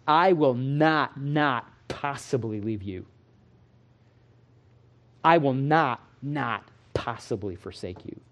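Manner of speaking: slow, falling to a hush on "not possibly"; emphatic negation. Shown as a demonstration. Preacher showing the Greek's force, slow then hushed. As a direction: press down on "will not". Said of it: "it comes off something like this".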